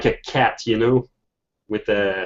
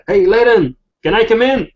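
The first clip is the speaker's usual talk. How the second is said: high, raised, eager call; young thief calling up; 10 semitones higher, 5 dB louder, faster than usual. Acted. Game master as the pickpocket asking to be let in, calling up. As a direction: call up to someone at a window. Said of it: "he just go like".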